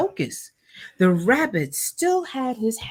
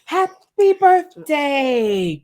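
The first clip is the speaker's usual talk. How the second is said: slow, loud, high joyful cry; snowman's first joyful words; exclaimed; 8 semitones higher, 6 dB louder, much slower than usual. A narrator's reading. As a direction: belt it out slowly, full of wonder. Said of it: "exclaimed Karen".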